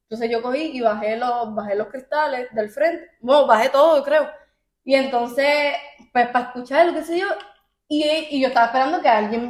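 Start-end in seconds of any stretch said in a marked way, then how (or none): none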